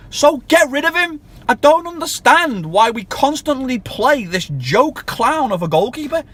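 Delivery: a fed-up fan losing patience; raging fan demanding the keeper be dropped; furious rant. Real, not acted.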